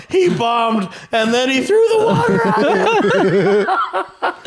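laughing shout. Retold or imitated. Retelling wild water-throwing story.

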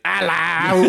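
high, laughing British hello; mock-posh English greeting through a laugh; British impression. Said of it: "Be like".

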